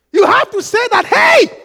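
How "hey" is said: high, urgent shout of hey; screamed call. Shown as a demonstration. Preacher showing when you have to scream, high shout. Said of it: "you have to scream".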